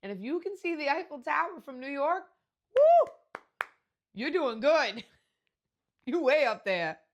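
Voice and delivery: loud, high teasing disbelief; mock incredulous. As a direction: tease her, loud and amazed. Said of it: "I'm like".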